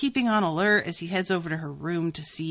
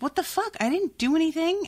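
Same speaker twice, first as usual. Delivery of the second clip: shocked protest; 9 semitones higher than usual. Retold or imitated. Cat reacts to being hit.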